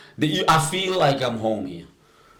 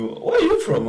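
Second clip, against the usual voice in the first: high mock voice of a stranger asking; imitating a curious local. Stranger quizzing the newcomer in the shop, high mock voice. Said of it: "a guy walking to me telling me".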